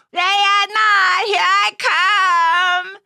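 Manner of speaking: loud, slow, drawn-out game call; loud puppet call. Acted. Puppet playing hide and seek, calling out loud and slow. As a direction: sing it out like a seeker.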